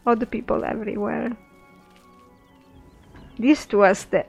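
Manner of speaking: ordinary talk, nothing marked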